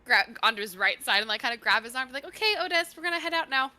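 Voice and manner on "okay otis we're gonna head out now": lightly acted coaxing, higher pitch. Gal steering Otis away by the arm, coaxing. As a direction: coax someone along in a raised voice.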